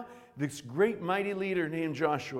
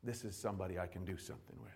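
hushed voice of the King; 7 semitones lower, 13 dB quieter than usual. Retold or imitated. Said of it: "And he'll say".

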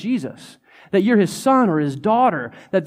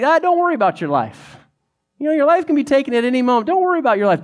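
casual Jesus voice; 8 semitones higher, 3 dB louder, much faster than usual. Retold or imitated. Jesus paraphrased casually to disciples.

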